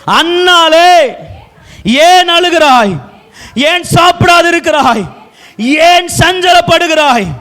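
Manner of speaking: high, ringing verse reading; preacher calling out the verse; declaimed scripture reading. Acted. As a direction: proclaim each question, pausing after it.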